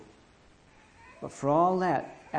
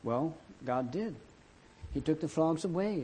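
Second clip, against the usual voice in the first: usual speaking voice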